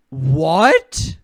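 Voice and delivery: loud shocked what; surprised. Real, not acted. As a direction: blurt it out in disbelief. Shocked reaction to news.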